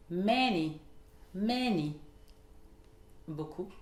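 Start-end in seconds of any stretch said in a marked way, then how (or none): 0.1-2.0 MA stressed in "Many", then a fall; first-syllable stress. Shown as a demonstration. Teacher models a common word, stress on MA in "Many". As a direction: hit the start: MA-ny. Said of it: "l'accentuation sur la première syllabe".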